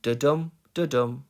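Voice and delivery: "da-dum" with the DUM stressed; rhythm demo. Shown as a demonstration. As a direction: mark the beat, weak da, strong dum. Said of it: "as you can see, you've got".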